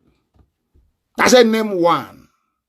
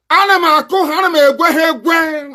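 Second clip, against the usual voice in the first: defiant shout; 8 semitones higher than usual. Real, not acted. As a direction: crow it out in defiance. Defiant boast in Igbo.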